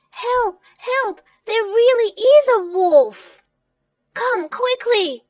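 high, loud alarm; high-pitched insistent yelling; shouting for help. Acted. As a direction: cry out urgently for rescue. Sam raises false alarm again.

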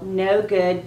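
ordinary talk

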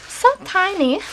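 high cooing gush at the tiny wallet; cooing over it. Real, not acted.

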